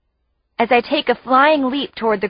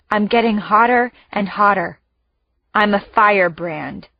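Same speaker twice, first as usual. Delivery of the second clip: ordinary delivery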